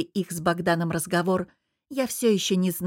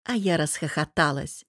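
plain reading voice

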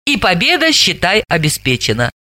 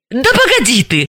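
higher-pitched annoyed interruption; mildly angry; 6 semitones higher than usual. A narrator's reading. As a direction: try to stop the tirade, a touch cross. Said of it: "рассердилась Далила". (немного сердито)

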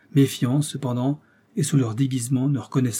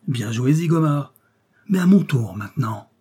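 mildly raised, called across to a rival; 4 dB louder than usual. A narrator's reading. Detective calling out to absent rival Zigomar, mild raised call. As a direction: toss a defiant challenge out loud. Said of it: "il cria".

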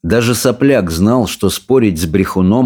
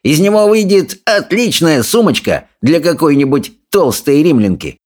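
sneering, sing-song bully, pitched way up; jeering bully; 7 semitones higher than usual. A narrator's reading. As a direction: taunt the others with relish.

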